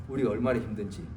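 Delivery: ordinary talk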